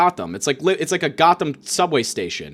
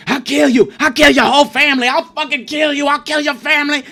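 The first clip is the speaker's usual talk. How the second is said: high, loud maniac yelling; mock crazed threat; 10 semitones higher, 6 dB louder than usual. Patient threatening neighbor through curtain, crazed yelling. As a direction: yell threats like a deranged patient.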